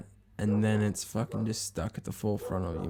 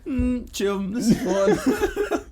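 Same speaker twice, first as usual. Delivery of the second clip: high, slow, laughing mock of a chilled-out stoner; mocking his friend; 13 semitones higher, 5 dB louder, much slower than usual. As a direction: drag it out high through a laugh, teasing. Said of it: "you're like".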